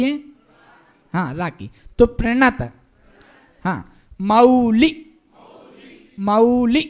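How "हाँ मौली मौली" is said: मौली with औ stretched, slow; drawn-out au. Shown as a demonstration. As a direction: sing the औ in मौली. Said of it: "औ की मात्रा है". (मौली में औ को खींचकर)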